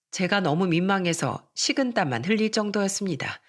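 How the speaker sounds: ordinary delivery, nothing marked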